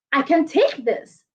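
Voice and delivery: confident example line. Shown as a demonstration. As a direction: say it deliberately, as if ready for it. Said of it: "you would have heard me say things like".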